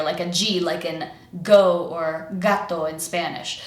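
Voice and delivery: usual speaking voice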